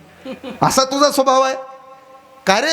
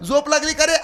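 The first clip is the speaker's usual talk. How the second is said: put-on old mother calling; 8 semitones higher than usual. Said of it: "परत म्हातारीनं आवाज दिला".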